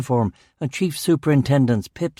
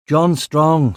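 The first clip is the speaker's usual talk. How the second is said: usual speaking voice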